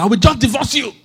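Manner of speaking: mock husband's high, loud outburst; threatening. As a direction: shout it as an exasperated threat, acted out. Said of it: "even threatened your wife".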